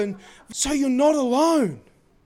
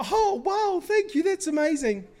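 mock delight; 7 semitones higher than usual. Imagined overjoyed response.

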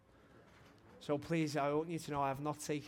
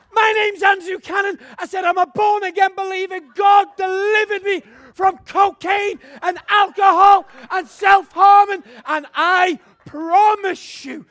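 shouted testimony; 23 dB louder than usual. Retold or imitated. Preacher reliving his testimony to the boss, shouting. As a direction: proclaim it at full force.

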